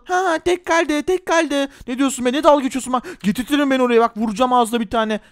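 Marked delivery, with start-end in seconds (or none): 0.0-1.7 high sneering singsong; mocking bully. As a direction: rub it in that he's left alone. Bully mocks.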